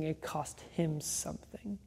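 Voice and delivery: a breathless hush, barely voiced; a reverent whispered aside to the congregation. Real, not acted. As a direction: whisper it as the solemn heart of the message. Solemn point at the cross.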